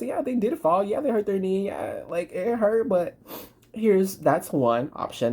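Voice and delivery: ordinary talk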